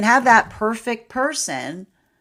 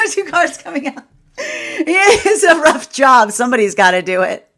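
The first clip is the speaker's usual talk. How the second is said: laughing mock complaint; 8 semitones higher, 10 dB louder than usual. Real, not acted. Reader jokes to viewers about the cards, laughing. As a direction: groan it jokingly while laughing.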